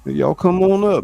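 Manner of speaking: usual speaking voice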